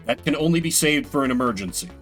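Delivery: ordinary talk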